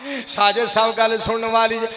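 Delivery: ordinary delivery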